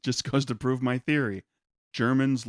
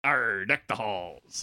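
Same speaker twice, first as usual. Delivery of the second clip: pirate voice; 3 semitones lower, much slower than usual. Pretend.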